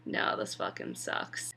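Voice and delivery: breathy whisper, voice dropped right away; whispered aside. Real, not acted. Viewer grumbling to herself about Sansa's marriage, whispered. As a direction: mutter it under your breath, bitter.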